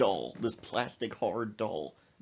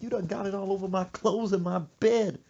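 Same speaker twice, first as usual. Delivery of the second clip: raised-pitch wail of complaint; complaining mama; 8 semitones higher, 4 dB louder than usual. Retold or imitated. Mother soaked in her bed, high mock wail. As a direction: whine it like an aggrieved mother. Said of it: "Then she like".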